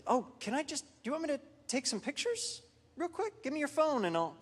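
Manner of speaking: a hint of a helpful nurse rushing in; nurse's eager offer. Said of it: "the nurse ran over and said".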